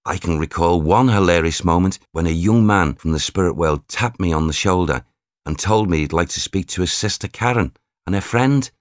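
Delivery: ordinary delivery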